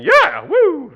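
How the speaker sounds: loud, high stadium whoop; game-day cheer. Retold or imitated. Pastor cheering at a football game, loud whoop. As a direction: cheer like the ball just went in. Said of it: "I may be up and saying".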